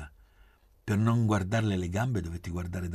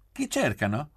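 higher, louder clear query; 8 semitones higher, 5 dB louder than usual. A narrator's reading. Blonde woman challenging strangers in her garden, clear voice. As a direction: ask it clearly, pitched up. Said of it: "disse una voce chiara".

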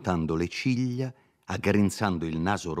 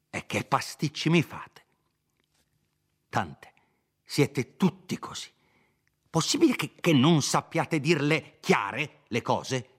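irritated lawyer; 8 semitones higher than usual. Acted. Dottore scolds confused Renzo.

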